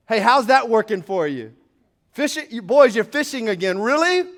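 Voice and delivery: Jesus needling the tired fishermen, then their flat reply; playful Jesus and weary fishermen. Acted. Preacher acting out Jesus and the disciples on the boat, loud teasing. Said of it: "That's what he's saying in this moment".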